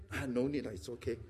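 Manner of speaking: soft, low, demure decline; falsely modest murmur; polite refusal. Acted. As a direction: decline meekly, dropping almost to nothing. Polite refusal despite wanting the drink.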